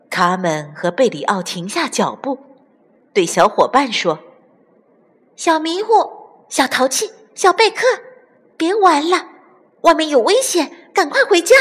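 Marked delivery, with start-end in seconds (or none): 5.4-11.5 high little voice calling friends; high-pitched childlike warning; urgent young chick. A narrator's reading. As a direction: call your playmates home, urgently. Chicks call friends home from danger.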